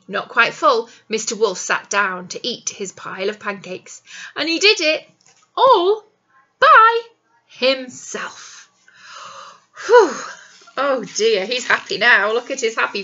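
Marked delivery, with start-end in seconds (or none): 4.4-8.7 each word louder and higher, then drop on "himself"; building emphasis. A narrator's reading. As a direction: punch "all" and "by" separately, rising.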